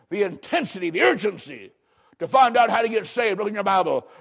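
ordinary delivery

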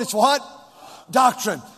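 ordinary delivery

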